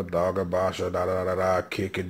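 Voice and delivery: ordinary talk, nothing marked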